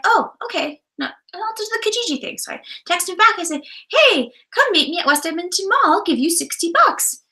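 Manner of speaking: high, chirpy retold haggling; breezy, high-pitched imitation of a friendly offer. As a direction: quote yourself in a light, high, agreeable voice. Quoting her own cheerful haggling.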